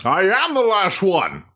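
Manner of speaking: Sean Connery dragon voice. Retold or imitated. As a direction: drawl it like an ancient dragon. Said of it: "uh, Sean Connery's".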